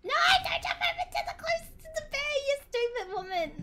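fast, high, loud angry yell; real rage at a teammate's blunder; furious shout. Real, not acted. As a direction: scream the order as she jumps anyway. Raging at companion's bad move.

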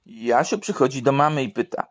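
ordinary talk

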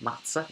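Matzah with a dentalized T, rising. Shown as a demonstration. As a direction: say Matzah with the tongue on the teeth. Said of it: "you hear the dentalization".

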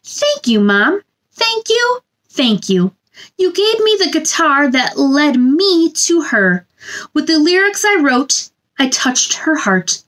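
gentle sing-song picture book reading; storybook read-aloud. A narrator's reading. Reading a picture book to children, sing-song storybook voice. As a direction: read it like bedtime story time for little ones.

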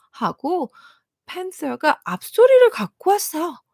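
ordinary delivery

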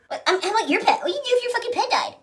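high, whiny mock of the protester, with a laugh. Parodying the deer protester, high mocking whine.

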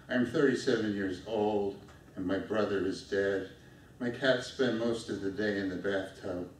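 normal talking voice

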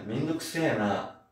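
ordinary talk

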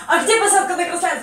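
loud, high, frantic asking; frantic shout. Retold or imitated. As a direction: yell it in a rush. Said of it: "говорю".

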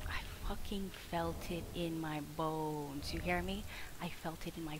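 unvoiced, intense whisper. Real, not acted. Streamer whispering to chat about a bad feeling, intense. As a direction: whisper it like a confession.

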